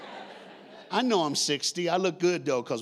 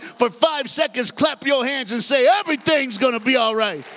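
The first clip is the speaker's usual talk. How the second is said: higher and louder, near sung preaching; preaching at full force; 7 semitones higher, 6 dB louder than usual. Acted. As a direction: drive it home at the peak. Preaching climax over music.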